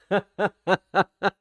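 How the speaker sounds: hearty, high chuckle; rich uncle laugh. Shown as a demonstration. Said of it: "Is that a rich uncle laugh?".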